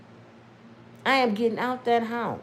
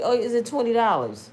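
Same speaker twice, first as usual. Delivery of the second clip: normal talking voice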